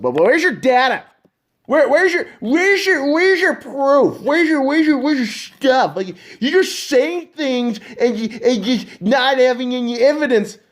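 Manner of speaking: high, stammering nagging voice; mocking whiny skeptic. Mocking listeners who demand his data, high and nagging. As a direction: parody a pestering doubter demanding proof. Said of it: "you're probably asking yourself".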